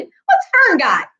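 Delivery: put-on high, slow, pushy boy's voice; demanding boy. A narrator's reading. Avery demanding to see what Fern has. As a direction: demand it like a nosy ten-year-old. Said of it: "he demanded".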